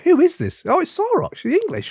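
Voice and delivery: high mock announcer voice for a promo. Host demos a mock podcast promo for the guest, high and hammy. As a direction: pitch it way up like a cheesy radio promo. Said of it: "It'll be like".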